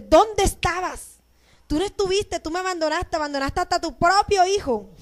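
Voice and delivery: reclamo agudo y dolido, actuado; échale en cara el abandono, con voz aguda; reclamo imitado. Retold or imitated. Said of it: "Le reclamó todo eso". (reclamo teatral al Padre)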